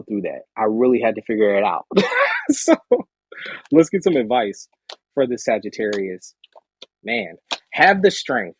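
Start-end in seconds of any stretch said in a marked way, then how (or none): 1.9-2.4 high laugh. Real, not acted. 7.0-8.5 slow encouragement. Real, not acted.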